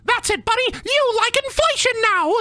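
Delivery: very high cartoon villain squeak; Bendy. Cartoon demon forcing a kink on victim, squeaky taunt. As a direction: squeal it like a bossy cartoon character. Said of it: "Bendy and the Kink Machine".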